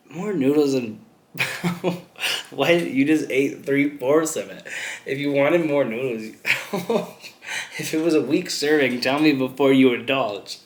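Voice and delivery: laughing, mock-indignant retort; incredulous laughing. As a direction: scoff in disbelief through laughter. Laughing at a customer's odd request.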